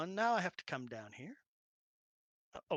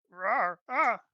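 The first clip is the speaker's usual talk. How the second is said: mock bear roar; 7 semitones higher, 14 dB louder than usual.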